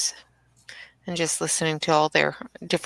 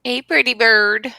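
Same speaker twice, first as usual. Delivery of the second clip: pet talk; 5 semitones higher, 3 dB louder than usual. Real, not acted. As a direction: sweet-talk the parrot. Talking to parrot.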